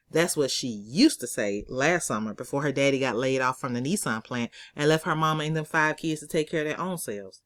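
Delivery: ordinary delivery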